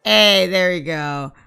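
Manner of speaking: ordinary delivery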